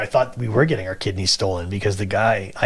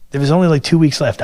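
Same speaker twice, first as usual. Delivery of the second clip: louder and higher, bursting with pride; excited; 6 semitones higher, 8 dB louder than usual. Real, not acted. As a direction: hit it like big news you can't hold in. Excited about becoming top salesman.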